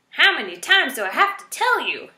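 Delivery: high, loud exasperated scream. A narrator's reading. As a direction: scream in his face, fed up. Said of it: "Erith screamed".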